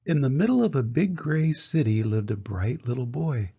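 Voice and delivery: plain reading voice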